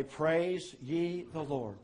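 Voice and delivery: deliberate, drawn-out praise; slow praise refrain. Acted. Preacher bidding all the earth say it, slow and drawn-out. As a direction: let each word stretch out slowly.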